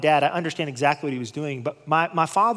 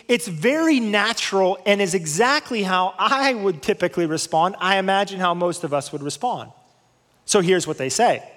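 ordinary talk, nothing marked

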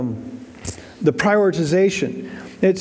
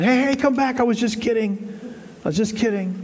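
lightly acted higher-pitched call back; mock calling back; 5 semitones higher than usual. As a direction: call after him as if taking it back. Imagined God calling man back.

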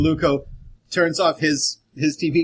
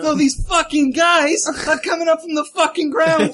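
loud, high, hammed-up Jersey accent; 12 semitones higher, 5 dB louder than usual. Jersey newscaster telling of the risen dead, shouted Jersey accent. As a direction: holler it like a mock Jersey local. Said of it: "I thought that was Jersey".

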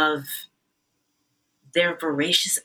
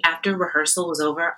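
usual speaking voice

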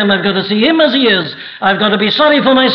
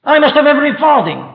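higher, pressing command, fast at the end; hard-hearted command; 8 semitones higher, 3 dB louder than usual. Retold or imitated. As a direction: order it without pity. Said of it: "he said".

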